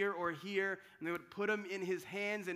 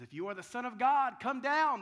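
louder jeering challenge; taunting mocker; 4 semitones higher, 8 dB louder than usual. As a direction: dare him to come down, scornfully. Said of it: "He says".

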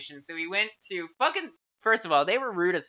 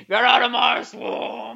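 loud, drawn-out ogre bellow; Shrek; 2 semitones higher, 6 dB louder, much slower than usual. Pretend. Friend playing the ogre ordering intruders out, hammed-up bellow. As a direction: bellow it slowly as a cartoon ogre. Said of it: "We'll just be Shrek".

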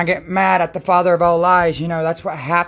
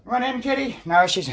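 ordinary delivery

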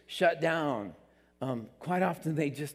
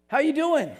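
cheery greeter; 12 semitones higher, 8 dB louder than usual. Pretend.

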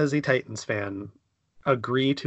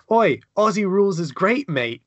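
mock Aussie; 7 semitones higher, 5 dB louder than usual. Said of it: "it's gonna be literally".

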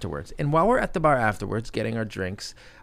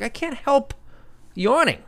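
much higher-pitched, exasperated self-defence; exasperated protest. Retold or imitated. Husband defending his yawns to his wife, exasperated. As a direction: plead helplessness, pitch shooting up.

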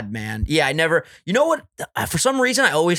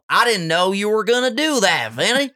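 higher, hammed-up Southern accent; exaggerated Southern accent; 4 semitones higher than usual. Surprised friend to Vinny, exaggerated Southern accent. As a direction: play the shocked friend in an overdone Southern accent. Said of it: "Is that his accent? So exaggerated".

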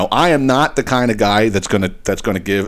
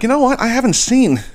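much higher, a different sudden voice; retold dawning surprise, pitched way up; sudden realization; 14 semitones higher than usual. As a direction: pop up high as it dawns on you. Retold moment of noticing missing post.

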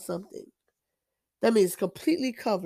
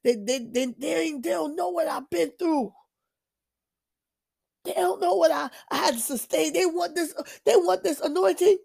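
higher, aggrieved venting; self-pitying complaint, pitched up; in my feelings; 7 semitones higher than usual. Retold or imitated. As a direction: whine about what they don't know. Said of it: "I'm like".

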